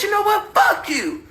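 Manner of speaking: high-pitched mock outburst; imitating an angry comeback; retold defiance. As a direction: throw it back in their face. Retold argument, she curses back.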